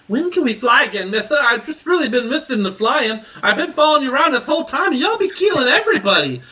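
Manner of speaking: put-on high small voice of a scruffy kid; timid waif voice. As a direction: play a shy little street kid.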